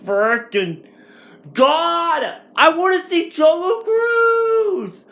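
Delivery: high, drawn-out wail about the movie; frustrated whiny outburst. Retold or imitated. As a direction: whine it like a kid denied a treat. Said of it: "and I was like".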